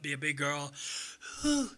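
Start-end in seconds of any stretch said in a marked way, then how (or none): 1.4-1.7 Anna Nicole Smith. Acted. Comic showing off an Anna Nicole Smith impression. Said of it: "I've done Anna Nicole Smith".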